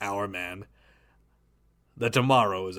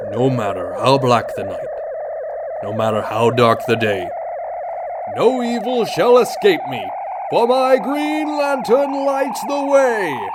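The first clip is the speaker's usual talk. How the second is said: oath building to a shout; 7 semitones higher, 6 dB louder than usual. Acted. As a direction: swear the oath with growing force. Hero recites the Green Lantern oath.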